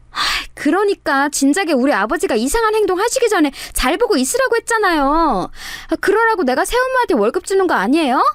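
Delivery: high, huffy stepdaughter act; mock scolding. Sister-in-law playing along as stepdaughter, mock scolding. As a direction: nag a stepmother in put-on annoyance. Play-acted scolding of stepmother.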